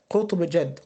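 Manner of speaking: normal talking voice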